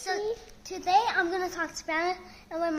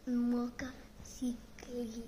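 whisper; 3 semitones lower, 6 dB quieter, much slower than usual. Pretend. Child invents fake Spanish word.

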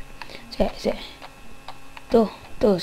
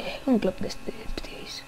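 unvoiced, much softer whisper; 10 dB quieter than usual. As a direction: whisper it conspiratorially. Repeats the request hushed. (susurro cómplice)

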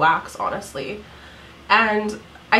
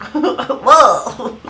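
laughing whoa; 4 semitones higher, 9 dB louder than usual. Retold or imitated. Reacting to own brows.

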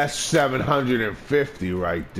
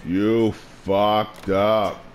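slow menace; 6 semitones lower, much slower than usual. Acted.

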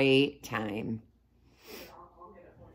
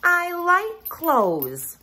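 put-on high, slow childlike mole rat; lightly voiced young mole rat; Wilbur voice; 13 semitones higher, 4 dB louder, much slower than usual. A narrator's reading. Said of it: "replied Wilbur".

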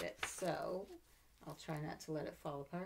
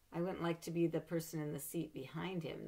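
ordinary delivery, nothing marked

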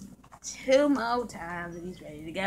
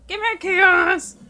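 louder slow demand; 6 semitones higher, 6 dB louder, slower than usual. Acted.

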